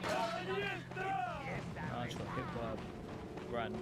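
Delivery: high, drawn-out startled yelp. Real, not acted. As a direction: caught off guard, stretch it out. Hit by a random object.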